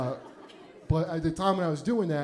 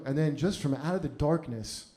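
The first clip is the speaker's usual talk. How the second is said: usual speaking voice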